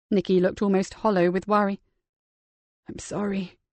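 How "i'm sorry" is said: soft murmur through a mask. Acted. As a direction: barely breathe the apology. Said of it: "he murmured through the mask".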